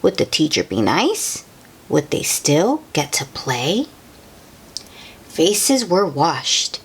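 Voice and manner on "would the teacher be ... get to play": ordinary delivery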